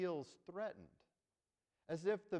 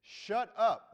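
sharp, much louder "Shut up"; stern rebuke; 6 semitones higher, 13 dB louder than usual. Acted. Jesus rebuking the unclean spirit, loud sharp command. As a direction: snap it to silence him. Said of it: "Jesus rebuked him, saying".

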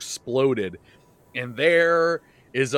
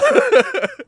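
laugh; 11 dB louder than usual. Real, not acted. Host laughs at episode title.

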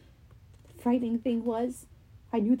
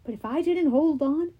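plain reading voice

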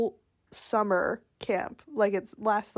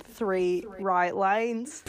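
slow put-on Australian accent; much slower than usual. Co-host mimicking the satnav, slow Australian accent. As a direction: draw the words out in playful Australian. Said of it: "working on my Australian accent".

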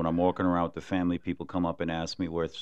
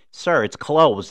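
high, louder, matter-of-fact lady; lady corrects him; 8 semitones higher, 9 dB louder, slower than usual. Retold or imitated. Other shopper telling the man the lane is closed, high and firm. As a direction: play the other shopper setting him straight.

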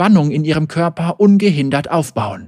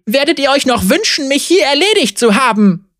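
mild shout; 10 semitones higher than usual. A narrator's reading. Zeri threatens the guards, high and half-shouted. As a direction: warn the guards off. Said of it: "schrie sie".

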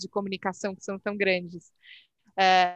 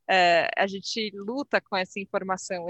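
ordinary delivery

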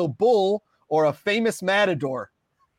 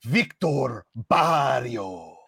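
Spanish flair; 7 semitones lower, much slower than usual. Pretend. Host rolls the Spanish name.

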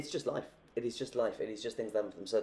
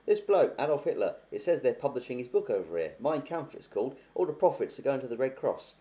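put-on higher, louder old bloke reading out news; chatty old husband; 5 semitones higher, 6 dB louder than usual. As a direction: announce the paper's news like a chirpy granddad. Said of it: "and just says".

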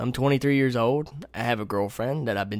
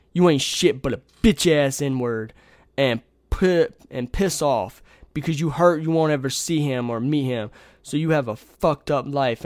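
loud, slow, heated readout of insults; angry message readout; 3 semitones higher, 6 dB louder than usual. Retold or imitated. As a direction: hammer out the insults, acting the sender. Reading out an abusive message.

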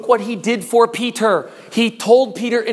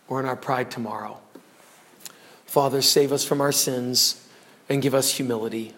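low, soft, reverent prayer voice; closing prayer; 8 semitones lower, 4 dB quieter than usual. Real, not acted. Preacher praying over the congregation, hushed and reverent. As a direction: pray quietly to close the sermon.

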